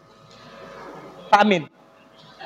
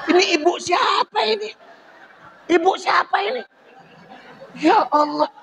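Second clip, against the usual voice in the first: laughing protest; 7 semitones higher than usual. Real, not acted. Laughing mock outrage at audience.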